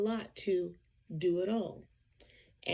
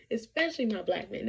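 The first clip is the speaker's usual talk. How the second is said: usual speaking voice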